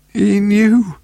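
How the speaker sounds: high, strained gasp of words. A narrator's reading. Dying woman to Paul, gasping her message. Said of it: "she gasped".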